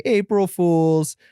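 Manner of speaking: slow, drawn-out gleeful April Fools; gloating reveal. Retold or imitated. Calling the sick boss back to reveal the prank, gloating. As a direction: savor the reveal, stretching it out.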